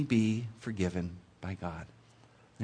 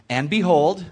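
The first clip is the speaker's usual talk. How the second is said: "And behold" punched loud and higher; emphatic announcement; 4 semitones higher, 6 dB louder than usual. A narrator's reading. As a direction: hit "And behold" like an exclamation point. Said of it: "It's like an exclamation point".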